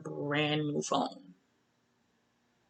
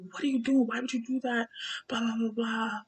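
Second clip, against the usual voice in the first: higher, upset demand for a reason; distressed protest; 5 semitones higher, faster than usual. Retold or imitated. Woman confronts partner who ripped her braids out, distressed. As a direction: plead for an explanation, alarmed. Retold protest after attack.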